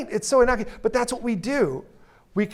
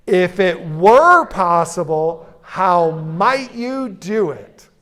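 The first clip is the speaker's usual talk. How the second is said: WERE stretched slow and pushed louder; stress on WERE; 5 dB louder, much slower than usual. Shown as a demonstration. Coach leads the room in saying the phrase together, stressing WERE. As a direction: draw out "were", then slow down through the question. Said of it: "if you stress the word were".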